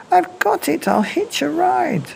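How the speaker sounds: high, eager little snail voice; excited snail cry. Acted. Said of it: "then cried".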